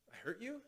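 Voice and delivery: soft echo question, YOU rising high at the end; gentle questioning echo with YOU swept upward; mirroring question. Shown as a demonstration. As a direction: repeat it back quietly as a question, lifting on YOU. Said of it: "we say it as a question".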